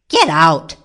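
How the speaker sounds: loud angry shout. A narrator's reading. Man catching rabbit in his garden, shouting. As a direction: shout it out sharply. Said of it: "he shouted".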